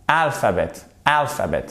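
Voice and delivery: alphabet with AL stressed, falling after; first-syllable stress. Shown as a demonstration. Teacher checking learners' first word, stress on first syllable. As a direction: hit the AL of "alphabet". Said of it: "c'est alphabet".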